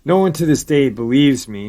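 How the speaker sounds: normal talking voice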